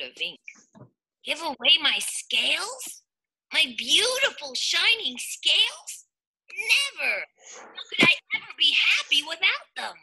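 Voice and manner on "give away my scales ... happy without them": distressed fish voice. Acted. Rainbow Fish refuses, upset.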